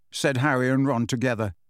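plain reading voice

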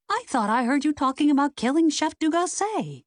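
high-pitched unthinking outburst; blurted out. A narrator's reading. Amateur sleuth to two suspects, blurted out. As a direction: blurt it out in one high rush of accusation. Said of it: "Lexi blurted it out without thinking".

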